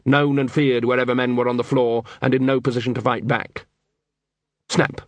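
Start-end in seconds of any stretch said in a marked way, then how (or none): none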